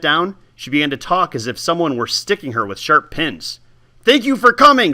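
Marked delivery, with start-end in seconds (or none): 4.0-4.9 high, loud pained cry. Acted. Old woman thanking the boy while in pain, loud cry. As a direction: blurt it out as if stuck with pins. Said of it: "she cried out".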